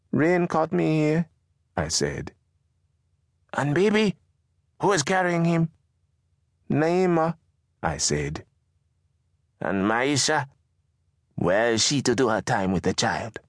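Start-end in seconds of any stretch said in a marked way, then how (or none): 0.1-1.3 put-on higher, slow boy voice. Boy answers. 3.5-5.7 higher, questioning mother. Acted. Mama voiced. 6.7-7.4 put-on higher boy voice. Boy answers. 9.6-13.4 lightly higher mother voice. Acted. Mama voiced.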